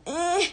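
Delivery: put-on high whiny baby squeal; imitated baby cry. Mother imitating her squirming baby's crying, high whine. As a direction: mimic a fussing baby's cry. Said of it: "he crying like".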